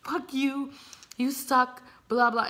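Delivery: acted-out angry swearing; retold swearing. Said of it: "I started swearing at him".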